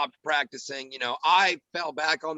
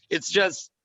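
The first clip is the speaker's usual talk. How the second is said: ordinary talk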